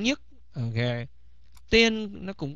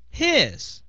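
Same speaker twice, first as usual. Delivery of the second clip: emphatic pointing; 9 semitones higher, 4 dB louder than usual. Acted. Pointing to third person's feet.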